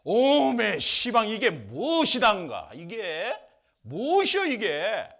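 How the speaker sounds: loud, high Jeolla-style cries of wonder; amazed delight. Retold or imitated. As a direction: gush over the strange food like a thrilled villager. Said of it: "하면서 막 그냥 궁금해가지고 막 기뻐한 거예요".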